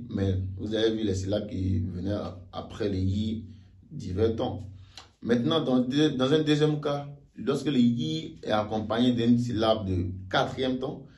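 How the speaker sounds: ordinary talk, nothing marked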